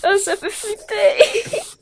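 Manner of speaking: slow, high, wavering fake fright; mock scared. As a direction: pretend to tremble with fear.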